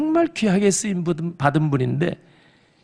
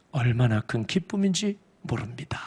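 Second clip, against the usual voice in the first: hushed whisper; 4 semitones lower, 8 dB quieter than usual. Real, not acted. Recalls his joy at installation.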